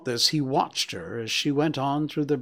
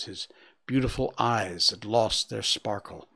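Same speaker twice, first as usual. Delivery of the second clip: usual speaking voice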